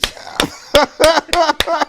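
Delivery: high loud burst of mock laughter; imitated table laugh. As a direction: explode like the whole table. Everybody laughing after her bye.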